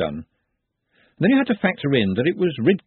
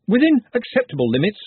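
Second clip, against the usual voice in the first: quick interjection; 9 semitones higher, 4 dB louder than usual. A narrator's reading. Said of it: "Ponder added quickly".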